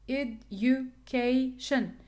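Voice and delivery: "education" broken slowly into ed-u-ca-tion; slow teacher's breakdown of "education", CA as KAY; syllable by syllable. Shown as a demonstration. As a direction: spell out "education" by sound, a gap after each syllable. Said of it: "the primary stress in K".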